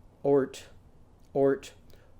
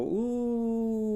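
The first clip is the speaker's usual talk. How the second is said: a higher, softer ooh while wiggling a tooth; loose tooth ooh; 6 semitones higher, 3 dB quieter than usual. Shown as a demonstration. Lulu wiggling her loose tooth, higher ooh. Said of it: "wiggle it, and go".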